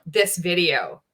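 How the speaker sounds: usual speaking voice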